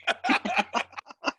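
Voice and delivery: unvoiced breathy laugh. Real, not acted. Laughing at own impression.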